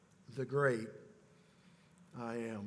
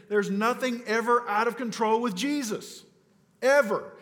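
ever pushed out higher and louder as a final punch; emphatic ever; 4 semitones higher, 3 dB louder than usual. Real, not acted. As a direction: land "ever." hard and loud on its own. Preacher insists on Jesus' control.

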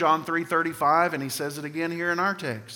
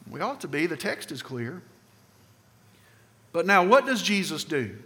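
normal talking voice

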